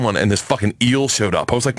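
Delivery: ordinary talk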